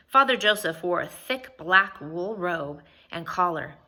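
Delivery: plain reading voice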